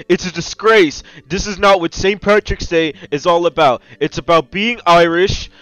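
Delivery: indignant. Acted. Customer defending Saint Patrick's Day to companion, indignant. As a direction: declare it with wounded outrage. Protests about wine on the holiday.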